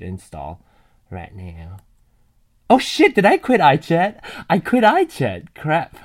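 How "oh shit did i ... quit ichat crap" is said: loud, high panicked exclamation; louder and higher, caught out by her own mistake; alarmed outburst. Real, not acted. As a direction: blurt it out in sudden dismay. Realizes she quit the chat app.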